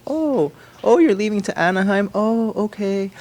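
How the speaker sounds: high-pitched, put-out mom imitation; mock attitude. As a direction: imitate a mom making a show of accepting it. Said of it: "answer with, uh, attitude and say".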